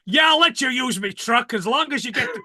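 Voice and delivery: lightly voiced New York trucker; New York accent. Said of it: "I can imagine him going".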